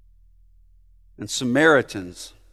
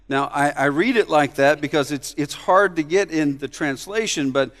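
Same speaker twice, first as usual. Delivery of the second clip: normal talking voice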